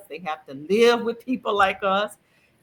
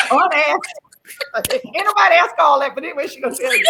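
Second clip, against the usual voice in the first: sassy teasing; 7 semitones higher, 6 dB louder, faster than usual. Acted. Teasing a guest who overshares.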